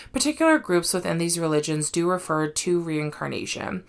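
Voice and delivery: normal talking voice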